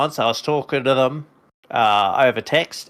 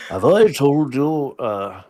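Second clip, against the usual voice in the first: Obi-Wan voice; 3 semitones higher than usual. Retold or imitated. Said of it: "What's my Alec Guinness voice".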